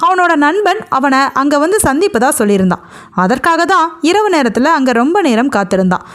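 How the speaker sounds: ordinary delivery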